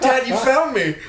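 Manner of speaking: playful mock son reunion; mock long-lost son. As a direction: pretend he's the lost child finding dad. Joke playing long-lost son.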